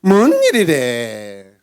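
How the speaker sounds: loud, slow, stretched-out astonishment; hammed-up wail of disbelief; astonished exclamation. Village women marvelling at Leah's births, exaggerated astonishment.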